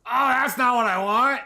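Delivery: high, loud exasperated cry; sudden loud oh-no outburst; frustrated yelp. Real, not acted. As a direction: blurt it out, annoyed and startled. Wrong item spawns in game.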